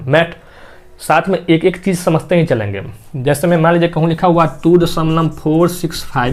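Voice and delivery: ordinary delivery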